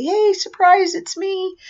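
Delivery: high, playful "surprise!" call; surprise greeting. Pretend. Clown greets aunt and uncle at the door, playful surprise. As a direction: sing out a mock surprise greeting.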